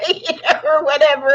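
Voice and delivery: giggling through the words; laughing. Real, not acted. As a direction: laugh it off lightly. Laughing at her own gaming.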